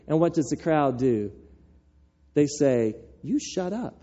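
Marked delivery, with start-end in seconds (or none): none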